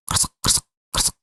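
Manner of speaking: voiceless rustling scrape; imitated dragging noise. A narrator's reading. Narrator imitating a dragging sound over the phone, voiceless rustle. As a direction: mimic something being dragged in short unvoiced bursts. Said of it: "Seperti suara sesuatu yang diseret".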